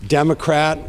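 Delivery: ordinary talk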